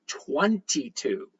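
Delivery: "twenty-two" dragged out very slowly with a falling end; slow emphatic twenty-two. Real, not acted. Stressing how many fake accounts copy him, very slow "twenty-two". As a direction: spell out "twenty-two" slowly to drive it home. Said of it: "not just one or two".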